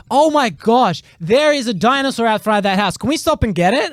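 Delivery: high, thrilled, over the moon about a dinosaur; excited wife. Retold or imitated. Husband mimics his wife's excitement spotting a dinosaur, high and thrilled. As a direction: gush like a wide-eyed kid spotting something. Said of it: "she's like".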